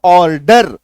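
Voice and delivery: ऑर्डर with both R's fully sounded; full R pronunciation. Shown as a demonstration. Teacher mimicking how students say it, ऑर्डर with full R's. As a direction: punch out ऑर्डर as "or-DER", loud. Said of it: "हम लोग पूरा पूरा ऑर्डर बोलते हैं ना".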